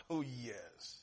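normal talking voice

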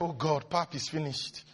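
soft, low mock dismay; quiet dismay. Preacher voicing someone finding the pap finished, quiet dismay. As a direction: murmur it low, as if let down. Acting out finding food gone.

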